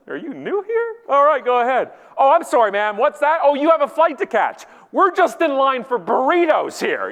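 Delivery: high, loud, exaggerated sarcasm; sarcastic mock sympathy. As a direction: scoff at a line-cutter's excuse. Mocking people who cut in line.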